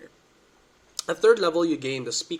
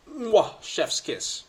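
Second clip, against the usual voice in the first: chef's kiss; 8 semitones higher, 4 dB louder, much slower than usual. Real, not acted. Praising cantrips.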